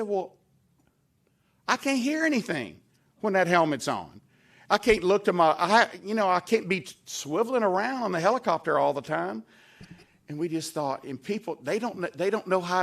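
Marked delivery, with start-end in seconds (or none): 0.0-9.4 higher, louder, earnest protest; animated retort. Retold or imitated. Governor tells the legislators why helmets are unsafe, animated. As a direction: argue it like it's obvious. Governor's retold testimony answer.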